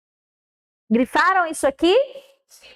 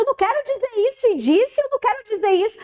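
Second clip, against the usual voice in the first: mild mock tearful protest, higher; retold crying; 7 semitones higher, faster than usual. Student in crisis refusing, a touch of tearful pleading. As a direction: lightly imitate a sobbing boy. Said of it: "chorando e dizendo".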